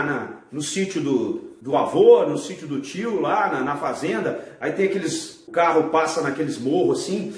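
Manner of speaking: ordinary talk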